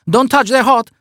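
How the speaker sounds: high, chirpy waiter warning. Acted. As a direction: warn brightly about hot plates. Waiter warns.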